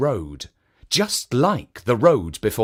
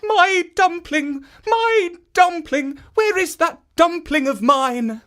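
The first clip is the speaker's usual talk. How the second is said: put-on old woman crying out; 3 dB louder than usual. A narrator's reading.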